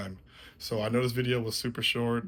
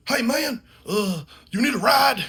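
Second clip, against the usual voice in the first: much higher, louder put-on friend voice; buddy's voice; 13 semitones higher, 9 dB louder than usual. School buddy calling out from the truck, high put-on voice. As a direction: pitch the buddy way up. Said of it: "And he goes".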